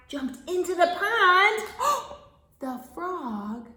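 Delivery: animated storytime narration with big swells; reading to children. Acted. Reader telling a farm story to small children, animated storytime voice. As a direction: stretch out key words for little listeners.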